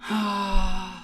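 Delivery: long weary sigh; bored sigh. Acted. Bored poem opens with sigh.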